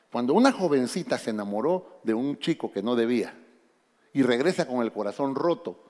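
ordinary talk, nothing marked

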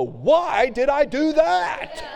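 high, slow, laughing whine of regret; regretful whine. Retold or imitated. Preacher mimics a sinner regretting his act, high and slow. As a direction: act out a person kicking himself. Pastor voices a regretful person.

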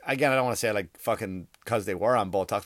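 usual speaking voice

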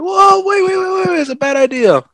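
high, loud panicky pleading; panicked protest. Retold or imitated. Running after the car in the dark, high panicked pleading. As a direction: yelp it as you bolt after the car. Fleeing the dark figure, scared.

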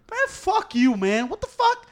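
high, loud retold outburst; heated cursing. Driver yells back at a finger out the window, heated. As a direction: curse him out, voice leaping up. Retelling his road quarrel.

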